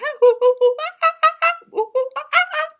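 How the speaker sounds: high, wild monkey cackle; monkey laughing. Acted. Monkey laughing at the rhino's request, high wild cackle. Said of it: "The monkey started laughing".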